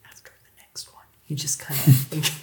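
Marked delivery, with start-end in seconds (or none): 0.1-1.1 hushed whisper, no voice at all. Acted. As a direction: whisper it so only allies hear. NPC speaks quietly before attack.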